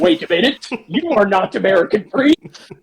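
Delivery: high mock soldier catching on; suspicious soldier. The soldier realizes Indy is no priest, high mock voice. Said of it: "the soldier's like".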